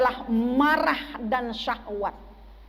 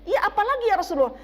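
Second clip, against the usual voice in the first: quick, higher questioner; man asking the Prophet; 7 semitones higher, much faster than usual. Retold or imitated. Man presses Rasulullah for more, lightly voiced quick higher questioner. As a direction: press for more, fast and high-pitched. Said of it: "ditanya oleh seorang laki-laki".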